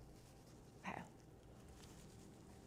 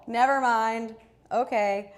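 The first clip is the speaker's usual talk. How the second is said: loud; 3 semitones higher, 12 dB louder, slower than usual. Real, not acted. Laughing it off loudly.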